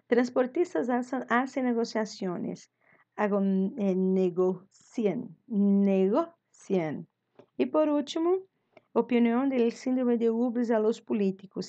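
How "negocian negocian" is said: "negocian" said slowly, syllable by syllable; slow drilled answer. Shown as a demonstration. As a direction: spell it out slowly for the class. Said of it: "teacher drills the verb".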